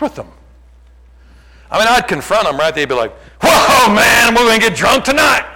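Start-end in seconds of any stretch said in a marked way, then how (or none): 3.4-5.5 mock party-happy yell, high and loud; excited shout. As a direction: whoop it up like an excited drinker. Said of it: "They'd be so excited about it".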